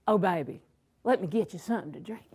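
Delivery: low, soft imitation of an eager boyfriend; doting sweet-talk. Imitating her husband when they were dating, doting and low. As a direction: coo it like a doting date.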